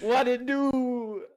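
loud, slow, drawn-out catchphrase; his catchphrase voice. Shown as a demonstration. Asked to speak, he drawls his catchphrase loudly. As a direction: lean back and stretch it out.

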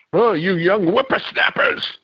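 put-on high, creaky old-timer griping; old prospector. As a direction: play a cranky old coot. Grumbling about being old.

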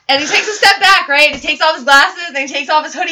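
usual speaking voice

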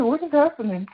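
high-pitched retold bewilderment; bewildered. Recalling her own meltdown, bewildered. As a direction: replay a baffled "what on earth" moment.

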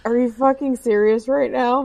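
high-pitched retold disbelief; incredulous. Woman reacting to friend who can't cut steak, incredulous.

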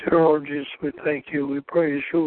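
normal talking voice

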